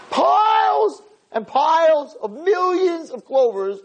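exclaimed in wonder at the endless heap; emphatic storytelling. Retold or imitated. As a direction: draw it out high and big for sheer scale. Animated retelling of story's scale.

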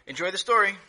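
ordinary delivery, nothing marked

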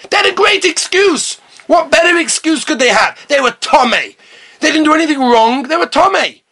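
high, insistent protest on their behalf; indignant argument. Acted. Preacher defending those who were Tomei, indignant. Arguing heatedly for the impure men.

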